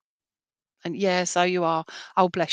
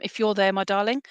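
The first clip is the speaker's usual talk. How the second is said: ordinary talk, nothing marked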